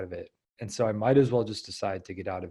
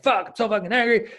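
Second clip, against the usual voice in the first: much higher, louder frustrated swearing; mock angry outburst; 12 semitones higher, 7 dB louder than usual. Coach acting out getting really angry, mock furious. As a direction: vent the rage, acted for effect. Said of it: "when you get really angry".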